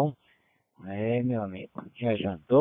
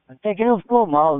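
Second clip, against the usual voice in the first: high, louder and quick; excited; 8 semitones higher, 7 dB louder, faster than usual. Real, not acted. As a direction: brighten up and rush it. Praising the echo sound.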